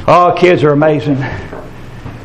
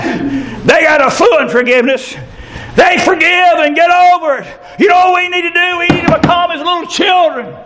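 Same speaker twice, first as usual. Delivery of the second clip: preaching climax; 12 semitones higher than usual. Acted. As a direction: build to a shout. Sermon climax, rising high.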